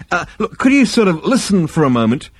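high, hesitant interruption; exasperated plea. A narrator's reading. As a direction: beg a lecturing elder to listen. Zaphod pleads to be heard.

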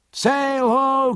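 high, drawn-out hail from aloft; high sung-out sailor's call; lookout's cry. A narrator's reading. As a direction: cry it down from the masthead. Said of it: "cried the masthead".

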